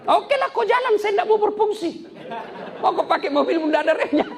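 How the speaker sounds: hammed-up high, loud sulking brake with laughter; sulky brake voice. The offended brake refusing to work for the car, high mock-sulky voice. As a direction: play the brake as an offended little diva.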